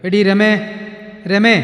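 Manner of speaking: loud, high call across the house. Acted. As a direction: shout for Rama from the doorway. Father calls wife from outside. (ഭാര്യയെ ഉറക്കെ വിളിക്കുന്ന അച്ഛൻ)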